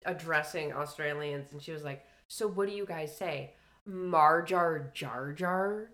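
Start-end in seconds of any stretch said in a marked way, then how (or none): 2.3-5.9 louder, slow mock American "Marjar Jar Jar"; mock American girl. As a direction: imitate an American drawling fake Aussie. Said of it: "this American chick".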